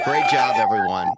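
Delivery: calling out over cheers; loud congratulations. Real, not acted. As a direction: congratulate the whole crowd loudly.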